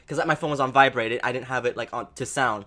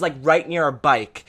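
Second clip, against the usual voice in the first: ordinary talk, nothing marked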